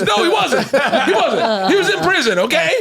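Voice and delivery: very high mock-outraged protest; imitated exasperated outburst. Donnell answering the psychic, high imitated exasperation. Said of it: "He goes".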